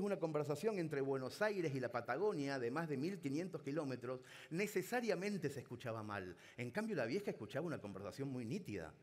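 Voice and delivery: ordinary talk, nothing marked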